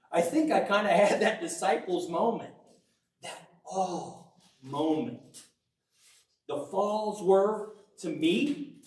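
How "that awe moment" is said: hushed awe. Real, not acted. Awed recollection of the falls.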